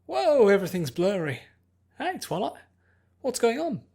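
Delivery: high, loud dazed excitement; a giddy girl blinking awake; bubbly Pinkie. Acted.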